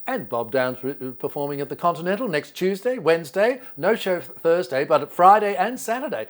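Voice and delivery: emphatic TV plug, pitch climbing; Bert Newton. Retold or imitated. TV host plugging a performer's club dates, showman's patter. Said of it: "he would say".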